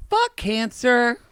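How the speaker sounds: slow emphatic fuck cancer; drawn-out catchphrase. Acted. Hosts chanting their sign-off, slow and emphatic. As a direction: drag out "fuck" with weight. Repeats catchphrase louder, slower.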